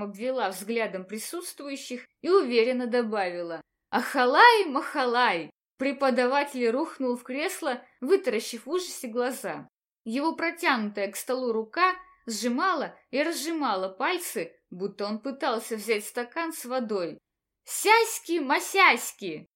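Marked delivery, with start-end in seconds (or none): 3.9-5.5 loud incantation. Acted. Mock magic incantation. 17.6-19.5 playful incantation. Acted. Mock magic incantation.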